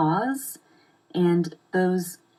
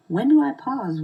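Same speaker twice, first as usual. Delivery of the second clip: higher, louder voice for a client's question; imitated questioner, raised pitch and volume; quoted client question; 8 semitones higher, 6 dB louder than usual. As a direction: voice a client asking, pitched up and emphatic. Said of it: "I've been asked lately".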